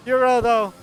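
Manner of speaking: ordinary talk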